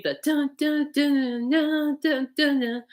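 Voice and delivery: high sung-out dun dun of a guitar riff; guitar riff imitation. Shown as a demonstration. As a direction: mimic the opening guitar riff. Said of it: "that original guitar riff".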